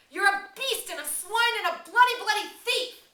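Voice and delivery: very high, very loud screaming insult; furious scream. Acted. Ralph screaming insults at Jack, his temper broken. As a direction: explode at Jack. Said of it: "He screamed at Jack".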